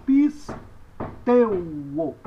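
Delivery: "pisteuo" broken up with a pause after "pis"; slow syllable-by-syllable model; pronunciation demo. Shown as a demonstration. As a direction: punch "pis", then stretch "teuo". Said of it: "Então, você diz".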